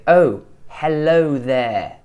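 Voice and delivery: slow British accent, "oh" from the front of the mouth. Shown as a demonstration. Coach showing how we say it, front-placed British oh. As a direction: draw out a very British "Oh, hello". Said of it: "Whereas we say".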